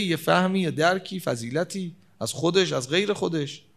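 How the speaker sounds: ordinary delivery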